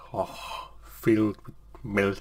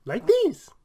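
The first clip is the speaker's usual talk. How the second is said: much higher, squeaky demo voice; 4 dB louder than usual. Shown as a demonstration. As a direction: jump up into the cartoon voice. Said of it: "get the other voice and put it together".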